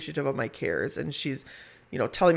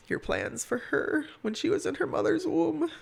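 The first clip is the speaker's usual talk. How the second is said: high, soft, reverent prayer; gentle prayer voice; 12 semitones higher, 4 dB quieter than usual. Retold or imitated. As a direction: pray softly and tenderly. Quoting Haley's prayer.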